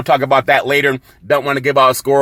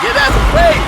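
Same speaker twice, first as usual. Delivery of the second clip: squeal; 3 dB louder than usual. Pretend. Sudden squeal.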